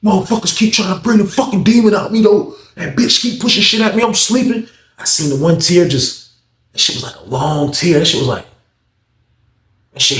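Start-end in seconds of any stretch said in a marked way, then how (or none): 0.0-4.7 high, agitated mock protest; imitating tearful complaint. Inmate with one tear venting to Weezy, imitated upset. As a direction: act out an upset inmate pleading his case. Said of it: "it was like one tear".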